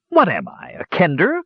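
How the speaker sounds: high-pitched indignant dwarf; insulted cry. A narrator's reading. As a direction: toss it back, offended. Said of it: "cried the insulted dwarf".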